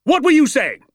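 high, loud demanding yell; parade-ground shout. A narrator's reading. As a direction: shout as if at a platoon of soldiers. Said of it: "shouting at me as though I was a platoon".

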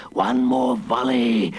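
slow, menacing monster voice; boasting villain. A narrator's reading. The Hooded Fang threatening the approaching boat, boasting. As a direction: draw it out as a gloating villain. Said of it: "boasted the Hooded Fang".

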